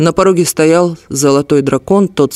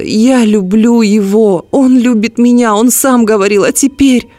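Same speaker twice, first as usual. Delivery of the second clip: higher, slow and pleading, voice climbing; girl defending her love, voice climbing higher; upset outburst; 6 semitones higher than usual. A narrator's reading. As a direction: protest in rising despair. Alice's outburst over lost love.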